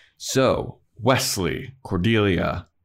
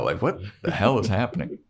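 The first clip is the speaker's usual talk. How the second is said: ordinary delivery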